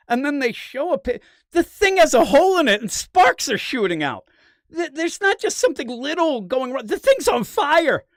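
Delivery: high, loud incredulous rant; exasperated disbelief. Real, not acted. Incredulous retelling of absurd scene.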